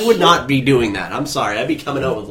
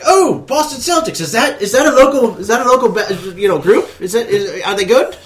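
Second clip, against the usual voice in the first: high, questioning impression of a frontman; mock-innocent stage banter; 9 semitones higher, 3 dB louder than usual. Visiting singer asks the crowd about a local group, mock innocent. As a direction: pitch it well up, playfully puzzled.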